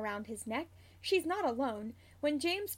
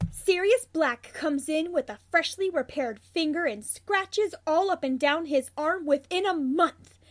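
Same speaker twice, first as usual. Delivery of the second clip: loud, higher, forceful prediction; emphatic; 4 semitones higher, 8 dB louder than usual. A narrator's reading. Marlene bets on Sirius getting hurt, emphatic. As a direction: punch it out like a wager. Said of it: "Marlene points for emphasis".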